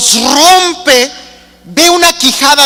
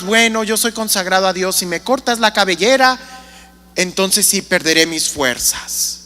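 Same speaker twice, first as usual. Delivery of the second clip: quiet confession; 6 semitones lower, 8 dB quieter than usual. Acted. Sansón confiesa su secreto.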